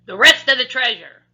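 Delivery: "The rest of the treasure" roared very loud and high. A narrator's reading. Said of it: "roared the captain".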